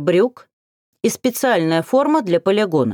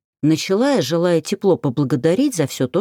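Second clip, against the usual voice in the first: usual speaking voice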